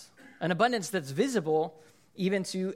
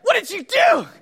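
high, loud comic accusation; mock outraged shout; 10 dB louder than usual. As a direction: shout it at the sandwich thief, hammed up. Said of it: "And I'm like".

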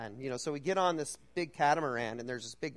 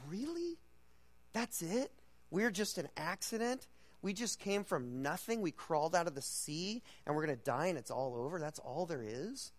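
pitched high, then sinking back; mock disbelief, climbing high on really; retold shocked challenge, starting high and settling; incredulous; 4 semitones higher than usual. Teller's own incredulous retort retold.

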